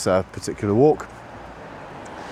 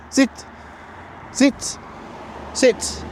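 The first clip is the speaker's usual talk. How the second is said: high sit command; 13 semitones higher, much slower than usual. Real, not acted. Command to dog.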